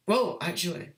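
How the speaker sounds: ordinary delivery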